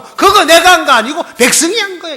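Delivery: loud, high, defensive excuse; mock the king passing the blame; mocking Saul's excuse. Preacher mimicking Saul's excuse, mocking and defensive.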